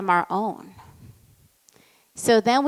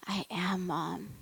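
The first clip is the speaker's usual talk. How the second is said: hushed whisper as her son; whispered reply; 3 semitones lower, 13 dB quieter than usual. Retold or imitated. Son standing taller after crying, whispering to mom, hushed. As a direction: breathe it out, barely voiced.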